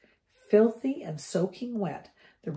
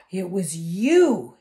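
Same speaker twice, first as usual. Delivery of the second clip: slow, loud dawning accusation; slowly; 10 dB louder, slower than usual. Acted. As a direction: draw the words out as it dawns. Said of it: "he said slowly".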